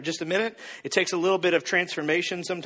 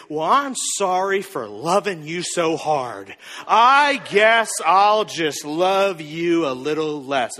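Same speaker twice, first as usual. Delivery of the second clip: slow, drawn-out mock apology; wounded sarcasm; slower than usual. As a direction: stretch every word in hurt sarcasm. Acted wounded sarcastic retort.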